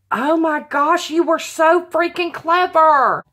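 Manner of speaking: high, excited gushing; delighted praise. Real, not acted. Crafter praising the gift's maker, delighted. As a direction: exclaim it, thrilled by their cleverness.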